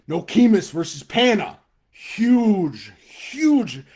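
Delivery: pumped-up sports call; excited hype. Real, not acted. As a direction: sell the big matchup. Hyping game of the week.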